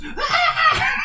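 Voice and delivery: high, loud mock shriek of fright; imitated scream. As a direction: squeal like the terrified lady. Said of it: "she goes".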